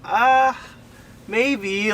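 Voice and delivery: hesitant hedging. Retold or imitated. Answering whether to lift the van, hesitant hedging. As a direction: hem out loud, mock-torn over it.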